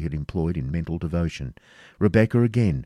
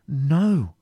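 higher, emphatic refusal; exclaimed denial; 9 semitones higher, 4 dB louder than usual. A narrator's reading. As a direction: deny it firmly with raised pitch. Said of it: "exclaimed Rebecca".

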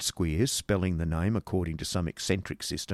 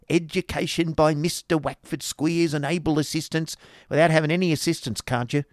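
high, argumentative wife voice; scornful schoolmaster's wife; 8 semitones higher, 4 dB louder than usual. A narrator's reading. Wife arguing with schoolmaster over assistants, scornful. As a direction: scold and override the husband.

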